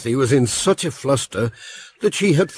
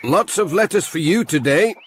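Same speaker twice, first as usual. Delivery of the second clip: higher, bright called greeting across the yard; calling out; 9 semitones higher than usual. Acted. Pat greets Dorothy at Thompson Ground, called out high. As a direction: call a greeting to a neighbour. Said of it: "Pat called out".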